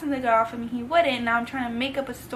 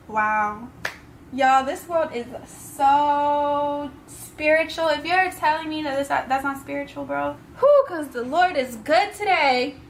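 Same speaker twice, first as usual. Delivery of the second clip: tearful; 3 semitones higher, 4 dB louder than usual. Real, not acted. Overcome while recounting answered prayer.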